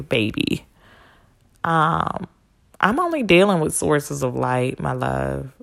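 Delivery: ordinary talk, nothing marked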